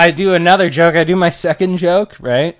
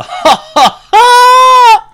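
laugh impression; 9 dB louder, much slower than usual. Shown as a demonstration. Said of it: "You kinda more do a".

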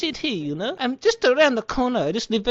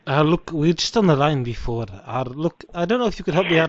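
much lower put-on man's voice, casual; different male caller; 6 semitones lower than usual. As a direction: drop the voice low to play a new caller asking about laptops. Same low caller voice again.

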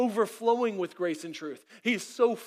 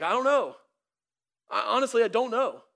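bewildered thief; 4 semitones higher, 6 dB louder than usual. Acted. Bewildered thief questioned in heaven.

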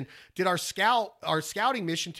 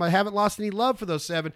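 ordinary delivery

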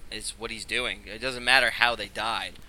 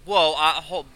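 louder, quick hold it; urgent; 6 semitones higher, 8 dB louder, slower than usual. Acted. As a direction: stop them before they strike. Wizard stops a threat.

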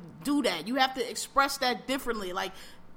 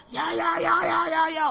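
higher, louder yeah-yeah chant, hammed up; mock constant yelling; 5 semitones higher, 3 dB louder than usual. Mocking the actor stuck on ten, mock shouting. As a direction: parody an actor who only shouts. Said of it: "there's no subtlety with him".

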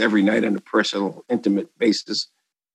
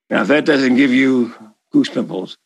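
ordinary talk, nothing marked

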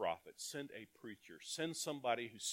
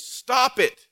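high, loud shout of Stop it; preacher shouting God's warning to sinners, loud and high; sharp stop command; 12 semitones higher, 16 dB louder than usual. Acted. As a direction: bark the command like God's rebuke. The message to Nineveh voiced.

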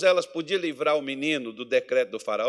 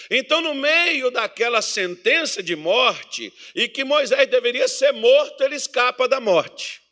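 louder, rhythmic call and response; full-force preaching; 8 semitones higher, 6 dB louder than usual. Acted. Preacher pressing the congregation with irmão, loudly. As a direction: drive each question home to the church.

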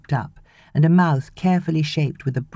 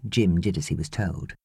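usual speaking voice

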